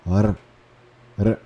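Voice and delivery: "R" rasped in the throat; throaty "R"; guttural R. Shown as a demonstration. Teacher repeats the guttural R sound. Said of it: "mais produzido".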